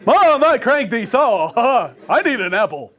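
acted little-boy bragging; proud boy calling. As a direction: call out proudly, a little breathless.

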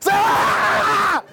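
hammed-up battle cry; swinging yell. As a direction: throw the punch with a shout. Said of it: "I swung just like".

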